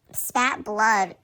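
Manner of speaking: ordinary delivery, nothing marked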